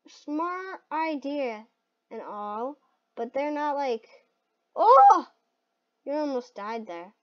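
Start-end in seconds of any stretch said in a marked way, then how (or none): 4.7-5.3 high, loud "oh!"; sudden shout of alarm; startled cry. Real, not acted. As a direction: yelp at a near miss. Startled by a near death.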